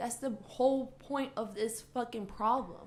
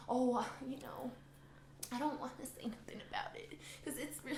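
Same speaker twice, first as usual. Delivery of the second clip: hushed, hesitant mumble trailing into a whisper; mock-timid, fading to a whisper; uncomfortable; 8 dB quieter than usual. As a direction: mimic someone shrinking from speaking up. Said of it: "because it's uncomfortable".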